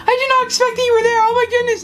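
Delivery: high, fast panicked babble; retold freak-out. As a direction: act out a startled rush of words. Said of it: "and I freak out".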